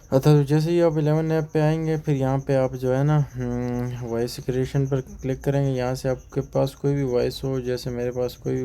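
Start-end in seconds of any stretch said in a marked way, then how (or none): none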